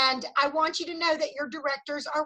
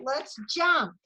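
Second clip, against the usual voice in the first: ordinary talk, nothing marked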